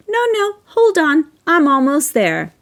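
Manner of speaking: high, drawn-out mock plea to wait; imitating the man. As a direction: slowly mimic him stalling, pitched well up. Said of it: "as if to say".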